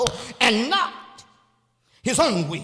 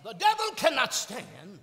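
ordinary delivery

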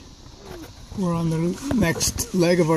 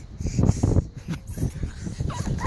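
high creaky cackle; 7 dB quieter than usual. Acted. Master character cackles.

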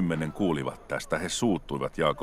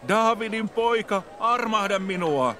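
much higher and slower, desperate plea; crying out louder; 3 dB louder, slower than usual. A narrator's reading. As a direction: call out even harder than before, drawn out. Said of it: "huusi entistä kovemmin". (vielä korkeampi, hidas ja epätoivoinen huuto)

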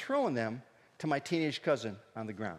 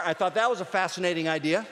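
usual speaking voice

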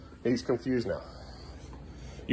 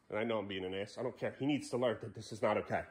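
low, much softer confiding voice; quiet aside; 10 semitones lower, 15 dB quieter than usual. Real, not acted. Parent explaining the scolding to viewers, quiet aside. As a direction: drop right down to justify yourself.

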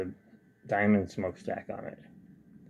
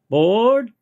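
high, loud drawn-out boarding call; conductor's call; 12 semitones higher, 10 dB louder than usual. Pretend. Model railroader sending the train off, high loud conductor's call.

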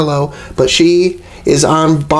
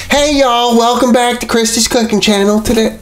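bright, high YouTube host greeting; cheery cooking show opener; host intro voice; 7 semitones higher than usual. Acted. Host intro take for channel.

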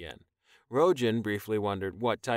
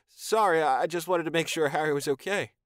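higher, louder, stammering excuse; flustered apology; 6 semitones higher, 4 dB louder than usual. Acted. Taion caught crooning to Harry, flustered and higher. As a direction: blurt it out caught in the act.

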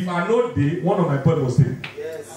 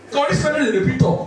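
ordinary talk

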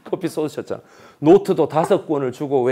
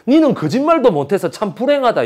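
higher, louder jab; mock scornful retort; 8 semitones higher, 5 dB louder than usual. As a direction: jeer at the old man. Retold retort to old man.

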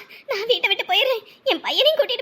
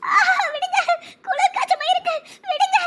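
whimpering plea; 6 semitones higher than usual. Acted. Girl feigns fever, pleads.

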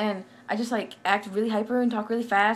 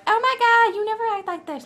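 put-on much higher, loud valley-girl gasp; generic anyone voice; 12 semitones higher, 7 dB louder than usual. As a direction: gush it like a shocked friend. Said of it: "That was my generic anyone voice".

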